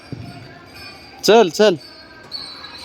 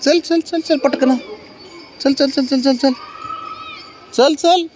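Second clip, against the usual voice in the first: high coaxing calls; 10 semitones higher, slower than usual. Real, not acted. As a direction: squeak it up high to hurry the cat along. Urging cat to go downstairs.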